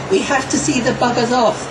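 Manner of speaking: usual speaking voice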